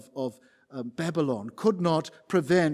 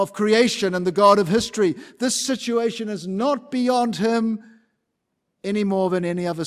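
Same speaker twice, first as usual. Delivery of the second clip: louder, driving sermon cadence; preaching at full force; 4 semitones higher, 7 dB louder than usual. Acted. Preacher rousing the congregation about God's power. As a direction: hammer each God of line.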